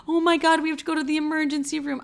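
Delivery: high, fast panicked rush; a scared ex rushing to help; panicked boyfriend. Retold or imitated. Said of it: "He's like".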